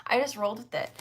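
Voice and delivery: usual speaking voice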